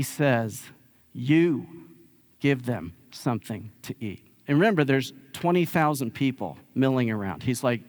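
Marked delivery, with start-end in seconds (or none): 1.1-4.3 mild ferocity, deliberate pauses; a firm retold command, heavy pause after "You"; ferocious response. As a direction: imitate a stern order, word by word. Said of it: "has kind of a ferocious response".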